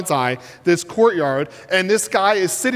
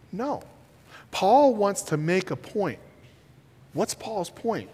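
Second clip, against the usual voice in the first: normal talking voice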